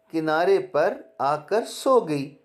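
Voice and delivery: usual speaking voice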